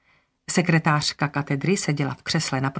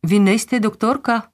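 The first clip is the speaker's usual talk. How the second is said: puzzled cleaner; 5 semitones higher, 4 dB louder than usual. A narrator's reading. Puzzled cleaner voiced in story.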